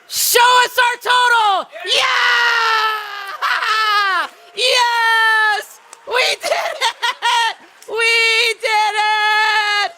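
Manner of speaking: high, shouted victory cheers with laughter; triumphant screaming celebration; jubilant shouting. Real, not acted. Fundraising total revealed, cheering.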